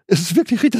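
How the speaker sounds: emphatic conviction. Retold or imitated. Preacher voicing his inner knowing, lifted and emphatic. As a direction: proclaim it with sudden certainty. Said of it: "dass ich sage".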